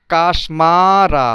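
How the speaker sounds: কাশমারা drawn out very slowly; slow teacher model of কাশমারা; slow model word. Shown as a demonstration. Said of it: "এ, কাশমারা".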